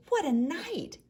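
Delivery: high bright bird chirp; twittering wren. Acted. Wren chirping about the storm, high twitter. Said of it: "twitters wren".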